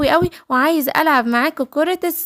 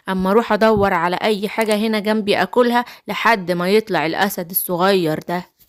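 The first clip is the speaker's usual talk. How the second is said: low-pitched wolf character voice; low, menacing storybook wolf; hungry wicked wolf; 6 semitones lower than usual. Acted.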